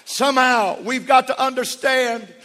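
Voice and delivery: loud, high, fervent preaching; preaching at full force. Acted. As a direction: preach it to the rafters.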